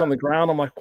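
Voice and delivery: ordinary talk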